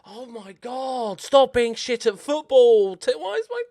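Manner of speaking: high, loud frustrated outburst at the players; exasperated yell. Real, not acted. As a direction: yell in disbelief at the missed chance. Team misses the chance.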